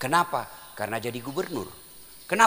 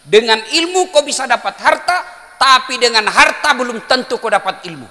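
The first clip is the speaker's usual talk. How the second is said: louder and higher, hammering it home; raised preaching voice; emphatic repeat; 6 semitones higher, 8 dB louder than usual. Shown as a demonstration. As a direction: proclaim it loud to the crowd. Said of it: "Saya ulang".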